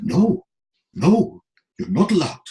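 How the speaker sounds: retold Russian official, mildly voiced accent; lightly hammed Russian accent. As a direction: do a playful Russian gatekeeper refusing. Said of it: "about your Russian accent".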